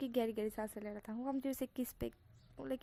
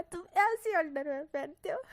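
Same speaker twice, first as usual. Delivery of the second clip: higher, louder, laughing through the words; 5 semitones higher, 8 dB louder than usual. Acted. Lover mocking Wing's underwear, laughing hard. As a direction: crack up at the underwear. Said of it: "उसे इतनी तेज हंसता हुआ देख".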